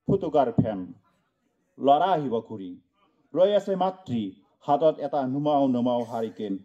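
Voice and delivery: low, hushed recitation; sombre drop. Acted. Poet telling of a mother awaiting her son, low sombre recitation. Mournful turn after the list.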